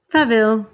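"Farvel" said carefully in Danish, a little high and loud; Danish farewell. Pretend. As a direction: pronounce "Farvel" proudly like a Dane. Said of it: "I said it beautifully".

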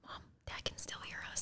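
hushed whisper. Real, not acted. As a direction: whisper so he can't hear. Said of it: "Why are you whispering?".

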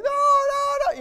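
put-on high, loud wailing child's no; crying toddler. Father imitating his crying son, high loud wail. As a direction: wail it like a three-year-old dreading a spanking. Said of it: "You know how he does it?".

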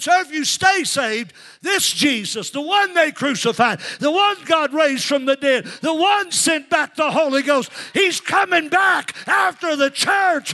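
high, shouted revival climax; full-force preaching. Retold or imitated. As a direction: build phrase by phrase into a drawn-out shout.

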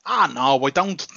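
usual speaking voice

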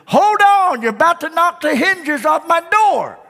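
high irritated complaint; annoyed householder. Retold or imitated. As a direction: stop the racket at the door. Annoyed man at the door.

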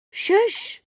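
high, sharp urgent shush. A narrator's reading. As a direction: cut her off with a hushing hiss. Urgently silencing her friend.

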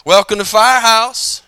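loud, high, slow greeting; expected welcome. Retold or imitated. Pastor imitating the welcome he expected, loud and slow. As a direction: call out the greeting he hoped to hear. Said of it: "what I was looking for".

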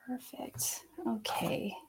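breathy whispered okay to herself; murmured okay under her breath. Real, not acted. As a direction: drop to a bare whisper. Whispers to herself mid reading.

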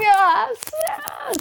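high, giddy double yes; thrilled yes. Retold or imitated. Aoife hearing she can sing her song, thrilled. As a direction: squeal a delighted yes twice.

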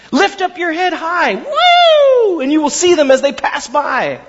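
high, loud, thrilled urging; excited urging. Acted. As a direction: whoop it up with delight. Tall tree urges little tree.

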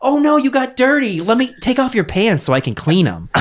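high-pitched parody fussing; mock concerned seductress. Lady tries to undress the plumber, mock concern. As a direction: coo it with hammed-up false concern.

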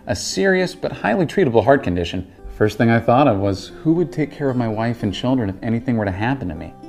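ordinary talk

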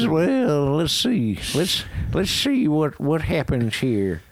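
slow, sly cartoon villain voice; Sheriff of Nottingham. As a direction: savor it like a scheming cartoon sheriff. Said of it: "the sheriff of Nottingham in the old classic Disney".

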